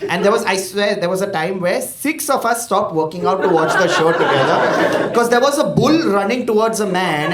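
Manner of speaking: ordinary talk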